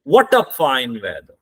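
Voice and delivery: What punched, voice falls by weather; slow, hit What then let it drop; stress on What, falling tone. Shown as a demonstration. Teacher showing how you exclaim at fine weather, stress on What. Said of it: "What आपने stress देके बोला".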